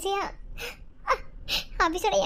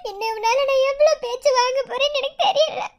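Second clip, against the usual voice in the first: higher-pitched tearful lament; crying; 4 semitones higher than usual. Acted. Girl lamenting trouble he causes her, crying. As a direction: let sobs push the pitch up. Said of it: "சரி சரி, நீ அழாத".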